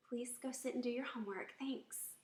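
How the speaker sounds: put-on polite, gentle request to a child; pleasant tone. Shown as a demonstration. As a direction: ask kindly, no edge. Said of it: "See the difference in the tone of voice".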